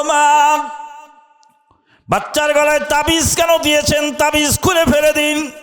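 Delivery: high, level preaching chant; retold plea, held on one high note; intoned appeal.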